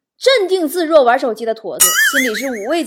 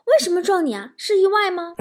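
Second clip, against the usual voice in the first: higher-pitched surprised questions; acted gasp of disbelief, pitched up; astonished; 5 semitones higher than usual. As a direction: imitate a startled fellow patient. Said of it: "病友很惊讶说".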